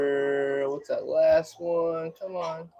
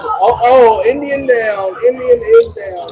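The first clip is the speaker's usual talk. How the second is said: surprised; 9 semitones higher, 10 dB louder than usual. Real, not acted. Mascot falls on the field.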